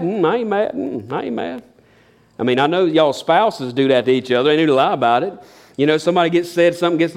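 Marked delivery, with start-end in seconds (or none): 0.0-1.6 sulky denial. Retold or imitated. Spouse sulking, denying anger to partner, pouting mumble. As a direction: grumble that you're not mad, clearly sulking.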